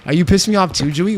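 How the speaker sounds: ordinary talk, nothing marked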